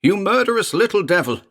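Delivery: high, loud furious scream; screaming at the little devil. Acted. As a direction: hurl it at the demon. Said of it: "Elliot screamed".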